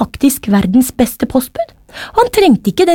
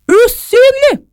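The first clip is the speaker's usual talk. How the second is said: high-pitched magical climax; dramatic reveal; 14 semitones higher, 4 dB louder, slower than usual. A narrator's reading. Mother reveals the hairpin's magic, high dramatic voice. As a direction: burst out with the secret word.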